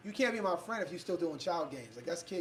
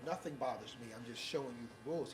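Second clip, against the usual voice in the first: calm reply; 7 semitones lower, 11 dB quieter than usual. Retold or imitated. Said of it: "I was like".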